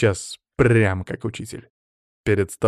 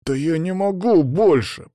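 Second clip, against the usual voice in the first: strained, higher-pitched complaint; forced out; 7 semitones higher than usual. A narrator's reading. Lyokha straining to hold the magic, forced out. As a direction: squeeze the words out through gritted teeth. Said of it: "процедил Лёха".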